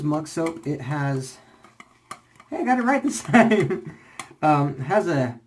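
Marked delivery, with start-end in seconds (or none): 2.5-4.0 laughing. Real, not acted. Pleased at getting name right.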